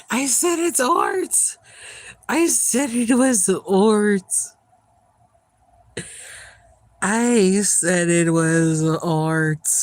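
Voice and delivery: loud slow insistent yell; building emphatic shout. Real, not acted. Repeating louder and slower.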